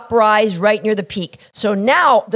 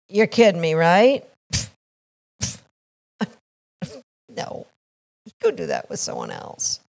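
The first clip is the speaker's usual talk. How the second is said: lazy dog; 4 semitones lower, 5 dB quieter than usual. Acted. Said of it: "picture your dog".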